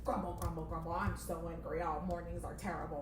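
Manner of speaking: low, grumbling angry boss; grumpy puppet boss voice. Acted. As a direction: mutter grumpily to yourself, low and sour. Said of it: "playing the boss character".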